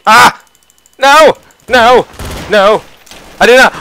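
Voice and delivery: panicked yelling; shouting. Real, not acted. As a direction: yell in alarm.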